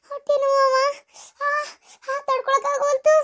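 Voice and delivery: high, tearful wail; acted labour-pain wail; crying in pain. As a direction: cry out to mother in pain.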